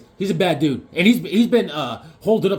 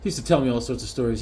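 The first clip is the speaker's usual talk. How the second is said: ordinary delivery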